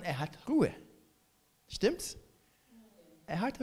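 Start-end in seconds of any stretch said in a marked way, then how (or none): none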